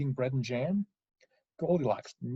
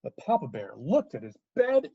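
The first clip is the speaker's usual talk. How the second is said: ordinary delivery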